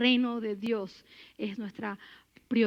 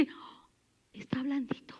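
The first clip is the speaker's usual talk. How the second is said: susurrado, casi sin voz; susurro; 9 dB quieter than usual. Acted. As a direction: dilo en un susurro de alivio. Describe al jefe ablandado. (un susurro cómplice al público)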